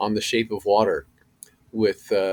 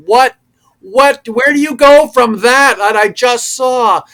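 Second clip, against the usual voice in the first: much higher, much louder, slowed disbelief; hammed-up outrage at wanting one more; stunned disbelief; 14 dB louder, slower than usual. Amazed at another take request.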